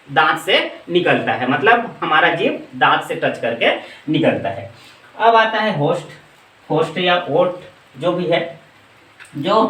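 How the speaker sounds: ordinary talk, nothing marked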